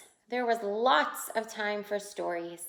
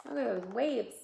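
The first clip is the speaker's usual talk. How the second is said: ordinary delivery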